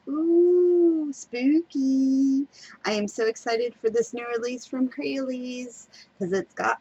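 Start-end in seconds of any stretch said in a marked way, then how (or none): 0.1-2.5 spooky ooh. Acted. Playful spooky bit after the scare.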